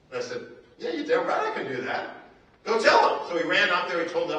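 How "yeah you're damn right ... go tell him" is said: high, loud and laughing; cocky excited reply. Retold or imitated. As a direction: fire it back, thrilled and sure. Bold retold reply at auction.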